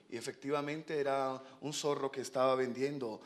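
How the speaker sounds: normal talking voice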